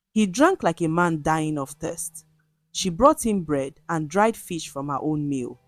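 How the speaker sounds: ordinary delivery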